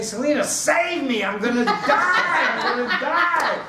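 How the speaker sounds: high, loud hammed-up wail; panicked pleading. Storyteller begs Selena to save him, mock terror. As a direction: beg for rescue, stretching out the final cry. Acted plea to friend in terror.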